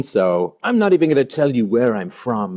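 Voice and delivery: usual speaking voice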